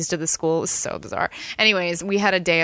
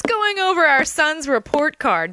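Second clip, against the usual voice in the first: sitcom wife; 10 semitones higher, 4 dB louder, slower than usual. Acted. Sitcom parody scene.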